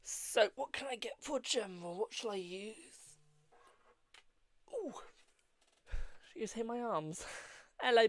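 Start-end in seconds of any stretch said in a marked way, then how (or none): none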